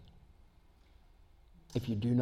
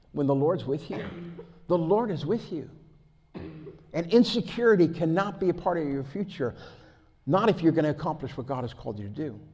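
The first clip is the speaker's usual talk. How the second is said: ordinary talk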